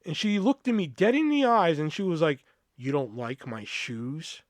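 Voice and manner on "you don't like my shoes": low, slow, soft challenge; confrontational mimicry. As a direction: imitate a slow, quiet stare-down question. Girl confronts him, staring dead-on.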